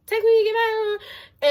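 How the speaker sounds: high, slow, sing-song "text me"; sing-song reply. Retold or imitated. To the guy leaving abruptly, a high, slow, sing-song reply. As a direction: lilt it up like a breezy sign-off. Quoting her own reply to him.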